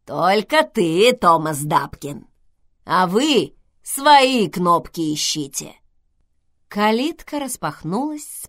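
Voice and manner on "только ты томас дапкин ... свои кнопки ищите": slow, loud creaky intercom voice; скрипучий голос. A narrator's reading. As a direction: creak it out slowly and loudly. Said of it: "проскрипело". (скрипучим голосом, громко и медленно)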